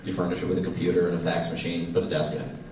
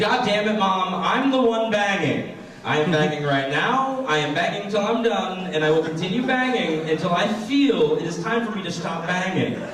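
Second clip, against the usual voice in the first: exasperated retort; 9 semitones higher than usual. Retold or imitated. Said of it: "I was like".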